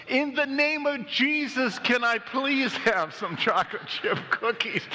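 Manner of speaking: put-on high-pitched kid crying out like a preacher; hammed-up little boy's public plea; child's shout. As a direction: holler it high as a desperate three-year-old. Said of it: "and he shouts".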